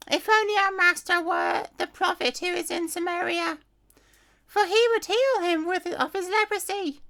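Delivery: a hopeful little maid speaking up to her mistress; young servant girl. Acted. A servant girl tells her mistress of the prophet, in a high girlish voice.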